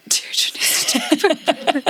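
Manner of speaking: high, whispered copy of "Deirdre needs to talk about Berlin"; mocking whisper. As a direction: echo the line back in a breathy mock hush. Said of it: "The way you say it".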